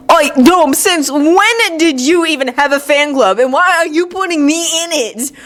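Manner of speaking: put-out squabbling; indignant. Acted. Sasuke snaps back in bickering.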